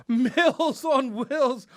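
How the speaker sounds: loud, slow, high delivery through laughter; laughing. Real, not acted. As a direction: relish the silly headline while cracking up. Cracks up at co-host's joke.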